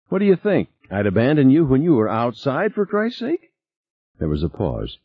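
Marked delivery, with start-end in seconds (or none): 0.1-3.5 high, indignant protest; affronted question, voice pushed well up. A narrator's reading. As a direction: protest the accusation, pitch climbing high. Accused character protests over intercom.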